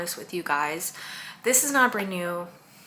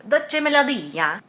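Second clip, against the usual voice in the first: high, loud, drawn-out Italian flourish; sing-song Italian accent, louder and slower; playful Italian accent; 5 semitones higher, 7 dB louder, slower than usual. Shown as a demonstration. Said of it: "I'm trying to be Italian with it".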